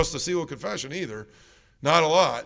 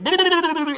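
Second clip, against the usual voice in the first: a sudden, much higher, louder squawk of alarm; high startled yelp; 13 semitones higher, 6 dB louder than usual. Retold or imitated. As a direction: mimic the priest freaking out. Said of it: "he was just freaking out".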